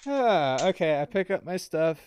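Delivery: ordinary talk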